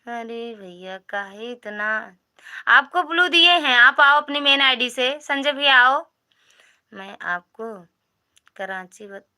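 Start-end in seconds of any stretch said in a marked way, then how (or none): 2.7-6.0 much higher and louder insistent call. Real, not acted. Streamer urging Sanjay to join from main ID, loud high call. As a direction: shout the invite to come on.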